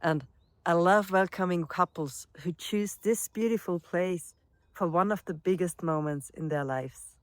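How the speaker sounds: ordinary talk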